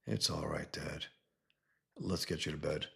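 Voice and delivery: very soft and gentle; calm a broken father quietly; soft reassurance. Acted. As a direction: son softly reassuring his dad.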